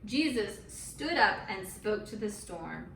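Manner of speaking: ordinary delivery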